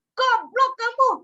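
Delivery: imitating a father snapping at his child; the harsh father scolding his daughter, imitated; angry father. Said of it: "dia langsung marah".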